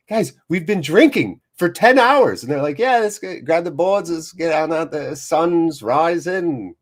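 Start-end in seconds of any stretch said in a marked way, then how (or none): 0.1-2.4 high, loud mock-pleading disbelief; incredulous protest. Worn-out drinker to tireless friends, mock incredulous protest. 2.8-6.7 high, hammed-up Australian surfer accent; horrible Australian accent. Surfers rallying friends at sunrise, bad Australian accent. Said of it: "That's my horrible Australian accent".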